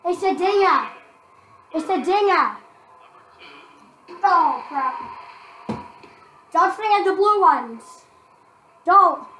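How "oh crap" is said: normal talking voice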